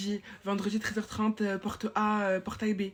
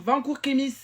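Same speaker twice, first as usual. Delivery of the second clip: louder, higher yell of an order; yelling at him; 5 semitones higher, 6 dB louder than usual. Real, not acted. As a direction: bark him back to class. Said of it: "je lui crie dessus".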